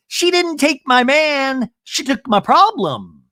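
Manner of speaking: high, loud, slow sass; hammed-up loud sassy quip; mock sassy declaration. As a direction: deliver it like a zinger. Imitating Shannon's sassy line.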